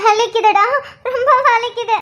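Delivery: acted tearful cry of pain. Woman in labour tells her husband it hurts, crying in pain. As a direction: sob through the pain as you complain.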